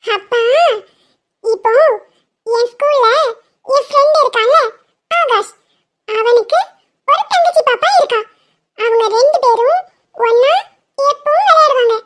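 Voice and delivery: put-on a small girl making her case; little girl voice. Child voice explaining to dad.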